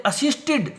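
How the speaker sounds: असिस्टेड ends with a clear id syllable; assisted with id ending. Shown as a demonstration. Teacher showing the id ending to students. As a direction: say असिस्टेड with the extra id. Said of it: "आवाज़ आएगी इड की".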